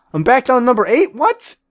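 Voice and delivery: very high, loud squeak of disbelief; indignant shock. Real, not acted. Gamer sees his rank drop and squeaks in outrage. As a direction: shriek it in outrage.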